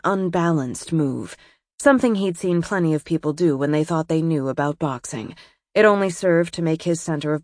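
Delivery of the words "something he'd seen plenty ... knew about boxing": plain reading voice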